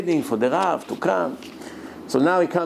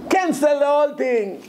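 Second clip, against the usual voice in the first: much higher, forceful "cancel"; furious order; 10 semitones higher than usual. Retold or imitated. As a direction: slam down the decision. Grandfather furious at the wedding.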